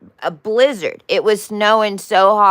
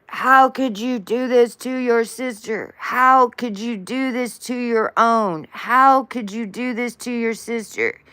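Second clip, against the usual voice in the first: higher, put-on voice of a scolding father; angry scolding; 7 semitones higher than usual. Father scolding brother for hurting his sister, higher voice. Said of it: "Father is screaming at brother".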